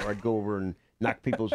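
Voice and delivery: normal talking voice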